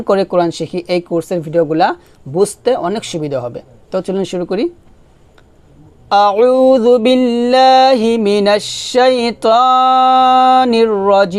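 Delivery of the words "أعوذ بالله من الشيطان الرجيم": slow melodic Quranic recitation. Acted. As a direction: chant it slowly, reverently. Opens the recitation.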